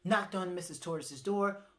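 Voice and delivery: plain reading voice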